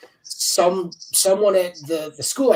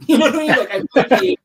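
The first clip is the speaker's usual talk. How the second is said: higher and louder, laughing through the words; 9 semitones higher, 7 dB louder, faster than usual. Real, not acted. Guest laughing at his own Spider-Man joke, chuckling through words.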